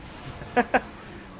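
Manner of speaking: breathy unvoiced chuckle; airy laugh. Real, not acted. Laughs at own praise.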